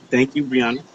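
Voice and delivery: ordinary talk, nothing marked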